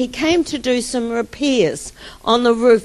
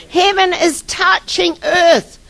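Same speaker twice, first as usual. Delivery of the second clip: normal talking voice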